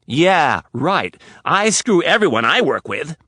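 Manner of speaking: loud scornful sarcasm; mock him bitterly; sarcastic. Riley scoffing at Rapp's excuse, loud sarcasm.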